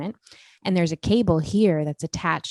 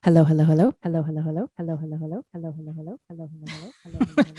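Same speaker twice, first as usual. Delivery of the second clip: repeats fading away; delay echo; much faster than usual. Shown as a demonstration. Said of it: "something called a d-, delay".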